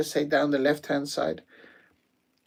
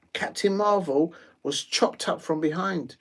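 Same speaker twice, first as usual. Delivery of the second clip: usual speaking voice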